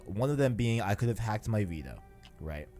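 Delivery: usual speaking voice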